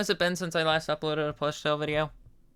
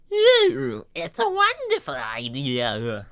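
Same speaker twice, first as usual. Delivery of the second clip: very slow sweet creepy flower; a hint of the sinister flower; drawn-out fake-sweet voice; Flowey impression; 2 semitones higher, 5 dB louder, much slower than usual. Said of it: "Flowey voice".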